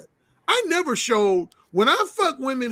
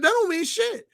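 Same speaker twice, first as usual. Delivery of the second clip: imitated dismissive retort, pitched up high; dead serious; 11 semitones higher than usual. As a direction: voice her flat-out denial in earnest, higher. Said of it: "she was dead serious".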